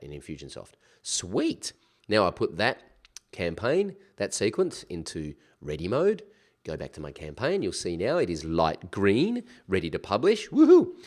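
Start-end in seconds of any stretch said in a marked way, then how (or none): 1.0-1.7 pleased. Real, not acted. Pleased the step is done. 10.5-11.0 high, loud happy cheer; excited whoop. Real, not acted. Celebrating the campaign being ready.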